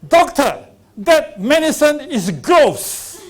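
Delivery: loud disgusted shout; retold shout. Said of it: "and he shouted".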